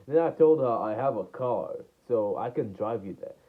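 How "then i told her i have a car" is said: plain reading voice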